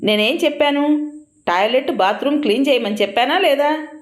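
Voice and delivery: high scolding outburst; husband scolding wife over the unclean toilet; angry shouting. A narrator's reading. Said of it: "గట్టిగా అరుస్తూ".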